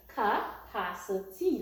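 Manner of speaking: "Capacity" slowly, broken into ca-pa-ci-ty; split into syllables. Shown as a demonstration. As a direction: sound out each syllable of "Capacity". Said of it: "Let's start with the syllables".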